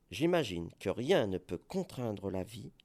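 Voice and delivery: usual speaking voice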